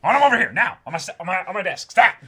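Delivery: high, loud demanding editor yell; J. Jonah Jameson parody. Retold or imitated. Editor demanding the hunter's photo, in a loud comic bark. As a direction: bark orders like a frantic newspaper boss. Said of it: "His voice does not fit at all".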